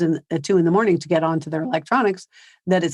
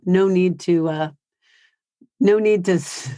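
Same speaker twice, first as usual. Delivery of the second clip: normal talking voice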